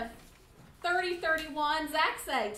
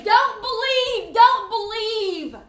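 slow, loud, higher taunting voice; voices of doubt; 7 semitones higher, 13 dB louder, slower than usual. Retold or imitated. Voices out there urging people not to believe, mocking. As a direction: drag out the doubters' call. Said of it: "there's voices out there that say".

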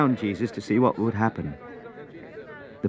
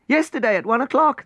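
eager; 4 dB louder than usual. Acted. Servants answer eagerly.

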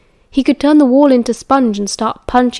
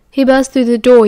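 ordinary delivery

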